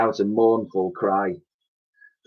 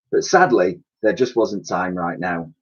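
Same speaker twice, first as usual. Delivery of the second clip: plain reading voice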